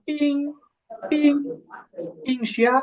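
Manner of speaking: পিং repeated as separate words, ending in ng, then joined in পিং শিয়াং; pronunciation demo. Shown as a demonstration. Teacher showing a student how ing joins p, pronunciation demo of পিং শিয়াং. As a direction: model পিং with a pause after each. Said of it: "আইএনজি ইং আর".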